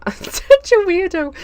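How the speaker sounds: high, slow, giggly self-mockery; laughing at herself. Real, not acted. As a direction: laugh through it, embarrassed. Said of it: "Ignore me".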